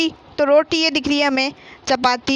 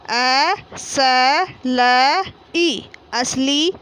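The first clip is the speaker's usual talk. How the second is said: slow spelling; much slower than usual. Shown as a demonstration. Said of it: "teacher spells then blends".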